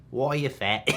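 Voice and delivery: imitating a New Zealand accent; mock New Zealand accent. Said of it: "Or whatever New Zealand accent he has".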